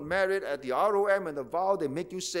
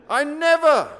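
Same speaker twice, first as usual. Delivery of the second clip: sharp, loud, high protest; shocked husband shouting his denial; indignant denial; 7 semitones higher, 7 dB louder than usual. Acted. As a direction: cry out that you never did. Husband denies in quarrel.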